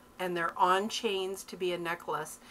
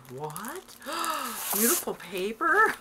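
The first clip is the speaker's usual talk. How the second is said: mock-astonished, with a big gasp, louder on the last words; sarcastic; 5 semitones higher, 5 dB louder than usual. Husband teasing wife about her love of paper, sarcastic. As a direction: imitate a sarcastic husband feigning amazement. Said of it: "sarcastically said".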